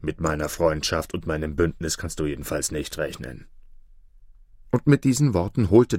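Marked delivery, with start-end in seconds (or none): 0.0-3.5 angry wolf. A narrator's reading. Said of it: "erwiderte der Wolf aufgebracht".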